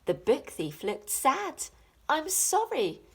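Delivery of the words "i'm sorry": sniffled. A narrator's reading. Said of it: "he sniffled".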